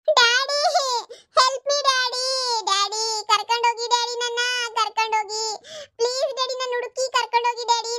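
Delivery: put-on a scared small boy begging his parents; crying child. Kidnapped child pleads again. (ಎತ್ತರದ ಧ್ವನಿಯಲ್ಲಿ ಅಳುವ ಪುಟ್ಟ ಮಗು)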